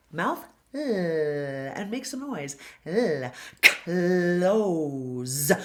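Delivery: "L" held and buzzing, then "close" ending on a buzzy Z; buzzing L demo. Shown as a demonstration. Coach showing the voiced L in "close", slow and buzzy. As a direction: hold the L and buzz, then land "close" on a Z. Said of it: "put the tip of the tongue here".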